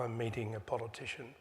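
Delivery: normal talking voice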